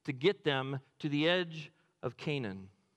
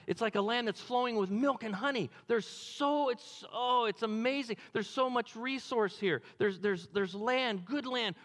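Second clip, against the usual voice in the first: higher, rushing with wonder; awed excitement; 6 semitones higher than usual. Acted. Spies report back to the people, awed and higher. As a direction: sell the land breathlessly.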